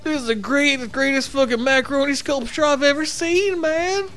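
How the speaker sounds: stoned hippie. Pretend. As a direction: gush like a spaced-out hippie at a craft fair. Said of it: "dressed up like a total fucking hippie".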